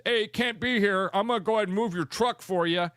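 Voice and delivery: lightly mock the arrogant intruder; mocking entitled guy. Imitating entitled Sanchez.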